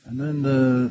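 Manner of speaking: normal talking voice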